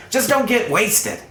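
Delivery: high, pleading caution; exasperated warning. Acted. Fed-up Pao warns the character.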